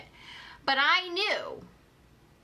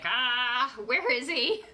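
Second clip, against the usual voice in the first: mock panic; 2 semitones higher, slower than usual. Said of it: "I was like".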